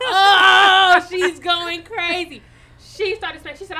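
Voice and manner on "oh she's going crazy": loud laughing burst, slow. Real, not acted. Watching the girlfriend blow up, loud laughing. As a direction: cackle it out slowly.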